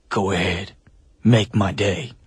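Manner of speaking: quiet, low menace, lightly played; Dirty Harry impression. Host retelling the hostage scene, with a hint of Dirty Harry. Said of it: "and he's just like".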